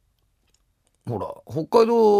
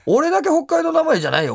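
indignant protest; 10 semitones higher than usual. Real, not acted. Host protesting to co-host about a listener's claim, indignant.

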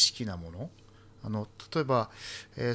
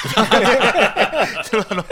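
laughing confession; 9 semitones higher, 11 dB louder, much faster than usual. Real, not acted. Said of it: "尊敬されると思って書いてるんですけど".

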